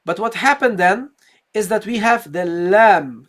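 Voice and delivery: ordinary delivery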